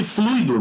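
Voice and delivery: "fluido" slow and high on FLUI, then do; diphthong ui. Shown as a demonstration. Teacher explains the unaccented word, "fluido" drawn out as FLUI-do. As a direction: lean hard on the first syllable of "fluido". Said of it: "não poderia ter acento".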